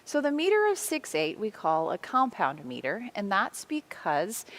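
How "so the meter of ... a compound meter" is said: ordinary talk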